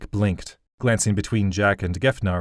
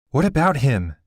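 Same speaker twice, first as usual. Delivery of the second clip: sharp, louder and higher demand; snapped; 6 semitones higher, 4 dB louder than usual. Acted. Allie snaps at the girl, louder and sharp. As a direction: snap the question at her. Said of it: "Allie snapped".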